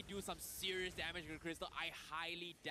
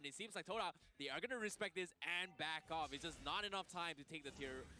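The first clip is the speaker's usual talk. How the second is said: esports caster; 3 semitones higher, 3 dB louder than usual. Real, not acted. Excited co-caster reacts to a play.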